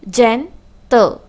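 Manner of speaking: "gentle" with a clear gap between gen and tle; split into syllables. Shown as a demonstration. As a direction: break GENTLE into two distinct beats. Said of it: "Two syllables".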